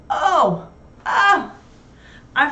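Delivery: loud high gasp of realisation; sudden alarm. Real, not acted. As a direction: yelp as it hits you that you forgot. Suddenly remembers the school pickup.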